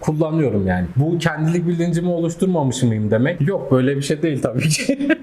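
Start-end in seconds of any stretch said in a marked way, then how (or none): none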